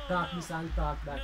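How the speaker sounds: ordinary talk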